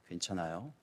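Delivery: soft, low and quiet caring question; a quiet "are you okay"; gentle concern. Retold or imitated. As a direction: ask it tenderly to calm a frightened employee. Gently asks scared employee.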